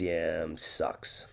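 low, soft, half-whispered mumble; hushed, weary and offhand; mumbled sucks. Retold or imitated. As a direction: mutter it like a tired man who can't be bothered. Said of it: "He looks at you and says".

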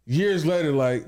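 normal talking voice

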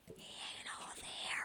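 breathy unvoiced aside; whisper. Real, not acted.

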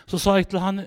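usual speaking voice

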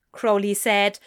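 ordinary delivery

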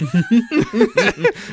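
loud squealing laugh burst; loud high laugh. Real, not acted.

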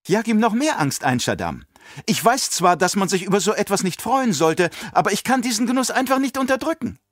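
put-on high childlike voice, relishing it; a child savouring the baron's fear; gloating little girl. A narrator's reading. Alia urges Shaddam on, gleeful child voice.

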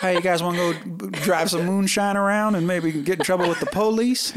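a rowdy Southern invite, laughing; mock Southern accent. Teasing Southerners with friends, laughing mock Southern accent. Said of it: "making fun of Southerners".

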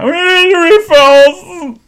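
very high, loud and slowly drawn-out whine; mock demanding call. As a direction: whine it like a needy customer. Guests calling to the server.

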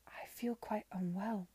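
slow, faint strangled whisper. Acted. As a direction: gasp it out, barely audible. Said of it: "not above a whisper".

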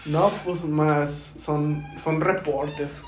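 mock-nervous, stretched-out stammer; imitated scared confession. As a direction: confess haltingly like a kid caught out. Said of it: "Y yo bien culeado".

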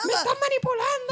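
high, whining mock complaint; mock whiny boyfriend. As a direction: ham up a guilty boyfriend. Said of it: "los tipos".

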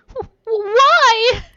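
high, loud stammering wail; forceful crying. Acted. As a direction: sob the question out. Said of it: "began crying forcefully".

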